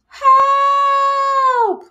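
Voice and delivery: high, loud cry for help; panicked scream. Acted. Spider flees the vacuum drill, screaming for help. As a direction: shriek it as you flee.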